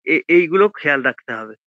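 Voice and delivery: ordinary talk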